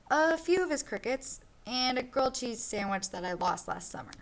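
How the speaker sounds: lively storybook read-aloud for a class; reading to children. Acted. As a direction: read it like a picture book to kids. Teacher reads picture book aloud.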